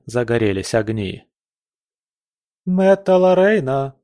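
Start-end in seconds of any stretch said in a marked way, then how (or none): 2.6-3.9 higher-pitched calling out; maid's cry. A narrator's reading. Maid calling for her young mistress in the park, calling out. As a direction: call her name from afar, pitched up. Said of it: "долетел крик горничной".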